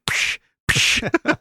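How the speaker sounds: imitated smacking "psh"; hissed mouth-made punch noise; punch sound effect. Shown as a demonstration. Imitating Star Trek punch sound effects, smacking psh. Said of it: "bright smacking, like".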